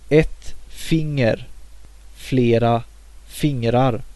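teacher modelling a Swedish plural; Swedish demo words. Shown as a demonstration. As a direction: model the Swedish forms for learners. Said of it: "The word finger is inflected the same".

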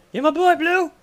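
high laughing squeal; squeaky repeat. Pretend. Repeats quote higher.

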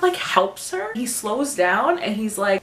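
normal talking voice

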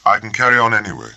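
firm, quick and decided; resolute. Acted. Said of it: "spoken with a kind of informal resolution".

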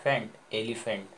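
फेंट alone, then elephant joined up; second part, then whole. Shown as a demonstration. Teacher rejoining the parts, फेंट then elephant whole. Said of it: "दोबारा क्या पढ़े?".

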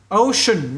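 "ocean" said slowly and rounded, OH-shun; English word demo. Shown as a demonstration. Teacher to students, slow careful pronunciation. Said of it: "nhớ ocean các bạn nha".

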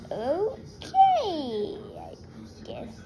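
long, high, drawn-out okay; sceptical giving in. Acted. Child humouring dad's accent change, exaggerated doubtful okay. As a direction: stretch it high, then let it fall.

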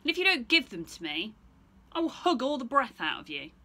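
loud, menacing bear voice; growly bear. Acted. Bear demands eggs from Stephen, loud and menacing. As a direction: threaten like a big bear.

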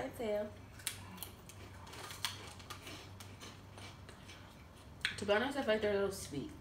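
chewing a snack chip; talking while eating. Real, not acted. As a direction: mouth partly full. Seen 0: Woman nibbles chip held at mouth throughout; speaker somewhat uncertain.

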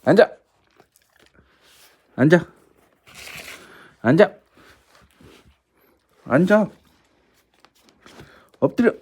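high loud commands. Real, not acted. Giving dog commands.

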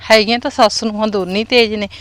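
ordinary talk, nothing marked

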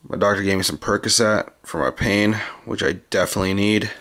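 usual speaking voice

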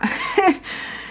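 soft high-pitched giggle; amused high giggle. Real, not acted. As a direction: giggle at her own bit. Laughs after own impression.

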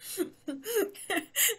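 breathless high giggle; high squealing laugh. Real, not acted. Laughing with candy stuck.

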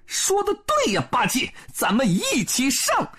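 very high Monkey King call; rallying shout. A narrator's reading. Sun Wukong agrees to attack together, high rallying shout. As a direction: call your partner to charge, pitched high. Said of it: "大叫一声".

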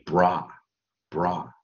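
bra, bra, slow with an open ah; pronounced bra. Shown as a demonstration. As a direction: draw out the ah in bra. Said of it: "我们就念".

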